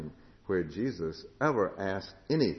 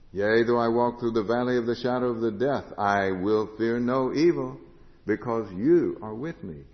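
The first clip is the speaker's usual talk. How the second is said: louder, measured scripture reading; preacher reciting Psalm 23 in full voice; psalm recitation; 3 semitones higher, 7 dB louder than usual. Acted. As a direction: proclaim the psalm with conviction.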